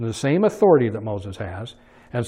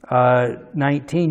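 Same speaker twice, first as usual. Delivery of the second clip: ordinary talk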